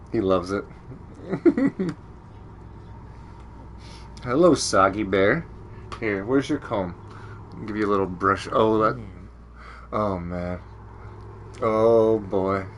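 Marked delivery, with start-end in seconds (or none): 4.2-12.7 low, soft cooing to a pet; soft dog talk. Real, not acted. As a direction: croon gently to the dog.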